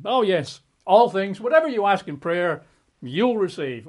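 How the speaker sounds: mock delight at the easy promise. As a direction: read it like a wish come true, thrilled. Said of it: "the way you and I would prefer it".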